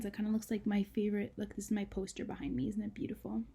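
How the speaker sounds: usual speaking voice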